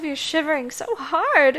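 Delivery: normal talking voice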